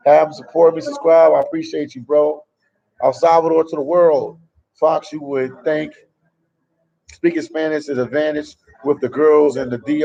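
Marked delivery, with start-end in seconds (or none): none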